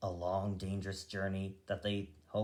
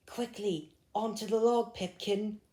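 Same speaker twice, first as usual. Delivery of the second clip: lightly higher rabbit voice; Fiver's voice; 9 semitones higher than usual. Acted. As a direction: urge him on, a little higher.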